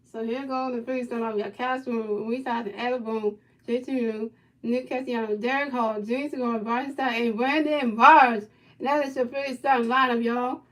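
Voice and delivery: loud ballpark announcer introducing the team; announcer lineup call. Pretend. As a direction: announce the starting lineup like a stadium PA.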